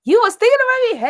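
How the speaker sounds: high, loud, quick excited squeal; delighted squeal. Real, not acted. Thrilled squeal answering a viewer's chat comment.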